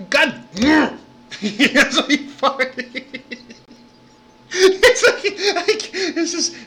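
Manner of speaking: high, breaking up with laughter; words swallowed by real laughing; helpless laughter. Real, not acted. Laughing at the clip.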